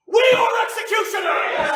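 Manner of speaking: high, loud ringing declaration. Acted. An anarchist rouses his comrades, a ringing declaration. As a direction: proclaim it to the hall.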